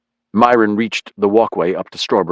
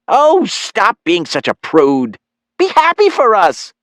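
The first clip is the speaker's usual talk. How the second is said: scolding mother; 13 semitones higher, 4 dB louder than usual. Acted. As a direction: chide your son in a loud, high singsong. Mother scolding her prudish son.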